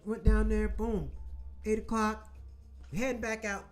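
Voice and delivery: normal talking voice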